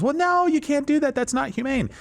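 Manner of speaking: high-pitched whiny mock objector; mock protest. Mimics what everybody says in objection.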